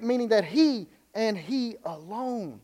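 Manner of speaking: both "he" words slow and weighty; x0.6, dwell on each "he"; "he and he alone" with each "he" hit hard; stress on HE. Shown as a demonstration. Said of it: "It is emphatic in the Greek".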